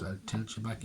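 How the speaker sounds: ordinary delivery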